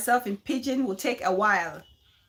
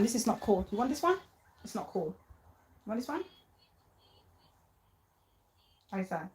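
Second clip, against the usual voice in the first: soft coaxing; 7 dB quieter, faster than usual. Real, not acted. Coaxing baby to eat.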